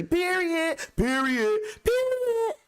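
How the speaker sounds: very high, punchy repeated Period; mock girls' period. Mimicking girls co-signing with period, very high mock voice. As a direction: squeal out Period three times.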